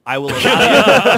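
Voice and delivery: loud and laughing; grand proclamation. Retold or imitated. Grand repeated permission.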